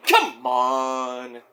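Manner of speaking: low, soft, drawn-out come on; like Roman. Retold or imitated. Fan imitating a wrestler's catchphrase, low and slow. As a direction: imitate Roman's deep grumble. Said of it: "Let me say it like Roman".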